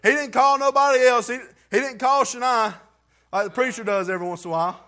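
high, loud, fervent preaching; preaching at full force. Acted. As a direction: shout the testimony to the church.

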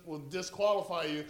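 ordinary talk

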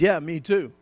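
casual, dismissive "me too"; offhand husband. Retold or imitated. Husband brushing off his wife's "I love you", offhand. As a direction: toss it off like a lazy husband. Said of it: "He says".

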